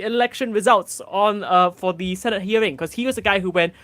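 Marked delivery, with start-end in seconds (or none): none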